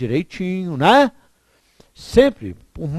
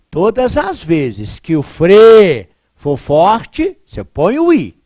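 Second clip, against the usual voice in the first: slow, loud, spelled-out rule; slow emphatic rule; 4 semitones higher, 9 dB louder, slower than usual. Shown as a demonstration. Teacher to class, hammering home the rule slowly. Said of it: "teacher stating the rule".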